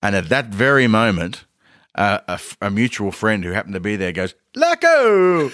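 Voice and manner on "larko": high, drawn-out hail; calling out. Retold or imitated. Mutual friend spots him mid-argument, high drawn-out call. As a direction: holler a mate's name in imitation. Friend calls out across the crowd.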